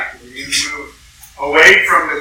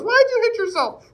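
mocking, much higher sing-song taunt; teasing brother. Preacher imitating a brother mocking a sibling. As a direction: taunt like a smug big brother. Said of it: "And then he goes".

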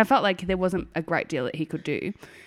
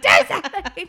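high re-enacted order through laughter; laughing mock demand; 11 semitones higher, 4 dB louder than usual. Mother replaying her demand to partner, high laughing mock yell. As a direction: replay the yell while cracking up.

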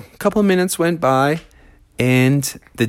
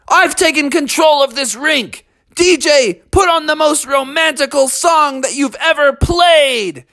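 grand shout; 11 semitones higher than usual. A narrator's reading. Froggy ordering the DJ, high grand shout. Froggy takes over rink.

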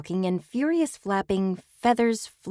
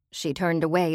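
usual speaking voice

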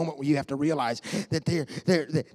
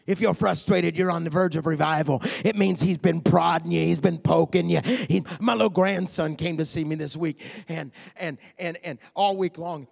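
ordinary talk, nothing marked

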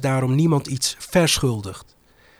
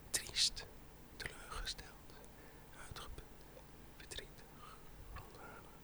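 fading to a whisper; 3 semitones lower, 11 dB quieter than usual. Acted. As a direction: list growing ever softer until whispered. List of feelings fading out.

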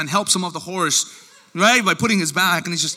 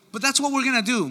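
ordinary delivery

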